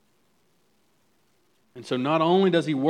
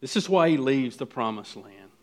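usual speaking voice

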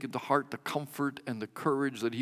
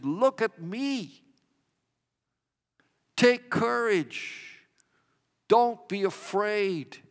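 Jesus speaking; 5 semitones higher, 6 dB louder than usual. Acted. Preacher voicing Jesus calling to those in the storm, ringing and raised. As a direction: proclaim it as Jesus lifting the frightened. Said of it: "Jesus comes to us and he says".

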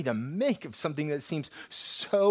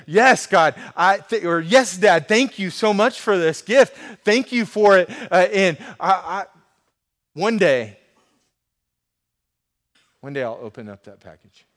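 eager thanks; 5 semitones higher, 13 dB louder than usual. Retold or imitated. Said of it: "Imagine if they were like".

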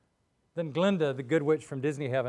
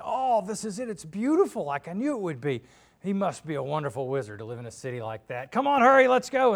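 high, thrilled and eager; rising to a loud eager call; excited; 4 semitones higher, 7 dB louder than usual. Acted. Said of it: "They say".

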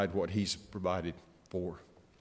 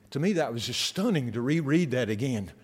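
usual speaking voice

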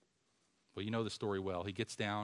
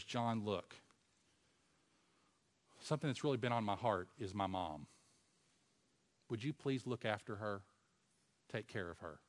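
softer, intimate dying request; tender quiet plea; 4 semitones lower, 7 dB quieter than usual. Acted. Jesus to John about his mother, soft plea. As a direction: speak it gently like a last wish.

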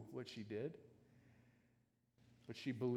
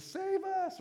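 sudden high, louder cry for help; panicked cry; 7 dB louder than usual. Retold or imitated. Disciples crying to Jesus in the storm, high panicked plea. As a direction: cry out like sailors sure they will drown. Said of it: "they cry out to Jesus".

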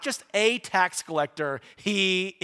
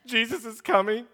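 mock sorrowful; 2 semitones higher than usual. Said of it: "He is not sorrowfully going".

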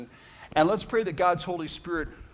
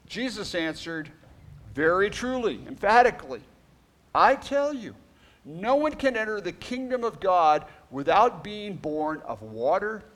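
higher, louder proclaiming of Jesus' words; scripture reading; 6 semitones higher, 4 dB louder than usual. Acted. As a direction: read it out with weight, pressing each line. Reads scripture aloud.

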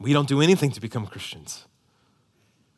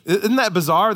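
usual speaking voice

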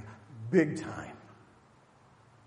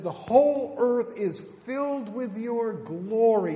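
loud, slow proclamation of glory; proclaimed cry; 7 semitones higher, 9 dB louder than usual. Retold or imitated. Preacher proclaiming the angels' praise, loud and slow.

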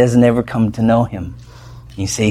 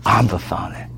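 softer, hushed echo of Abba Father; tender repeat; 6 semitones lower, 6 dB quieter than usual. Shown as a demonstration. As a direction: let it linger gently, softly.

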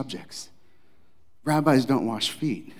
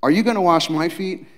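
surprised Peter; 7 semitones higher, 10 dB louder than usual. Acted. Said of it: "He says".

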